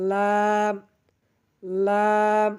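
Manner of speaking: "লাম" held long, tongue edge at upper gums; touch tongue to tooth roots and stretch "লাম"; tongue-edge sound. Shown as a demonstration. Teacher showing learners the letter, "লাম" drawn out evenly. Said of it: "জিহ্বার আগার কিনারা".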